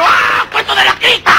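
high mock-scared yell; frightened child. Child reacting to the mean father, hammed-up high scream. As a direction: cry out in cartoon horror. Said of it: "El niño".

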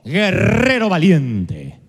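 slow and stately, a divine salute; God greeting a frightened man, slow and grand; slow solemn greeting. Acted. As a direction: draw out "guerrero valiente" with weight. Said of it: "el saludo de Dios es".

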